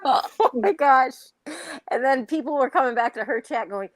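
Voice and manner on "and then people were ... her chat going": usual speaking voice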